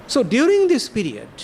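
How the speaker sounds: ordinary talk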